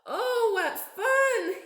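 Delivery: high, slow, thrilled cry; delighted. A narrator's reading. Bear sliding in soap flakes, high delighted cry. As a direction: squeal with joy. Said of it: "he said with a smile".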